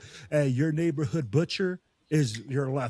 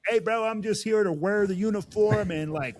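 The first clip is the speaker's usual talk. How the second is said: carefree teammate; 7 semitones higher than usual. Retold or imitated. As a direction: play the lazy guy who's just there for the look. Voicing a lazy teammate.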